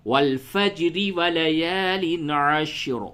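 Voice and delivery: very slow chanted والفجر ولیال عشر with thin ra; وصل میں تلاوت; joined recitation. Shown as a demonstration. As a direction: recite it joined, drawn out in tajweed. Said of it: "حالت وصل یعنی ملانے".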